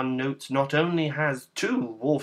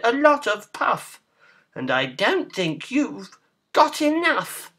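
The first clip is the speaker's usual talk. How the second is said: high, loud piggy taunt; defiant pig; 9 semitones higher, 5 dB louder than usual. A narrator's reading. Pig doubting the wolf's puff, high taunting voice.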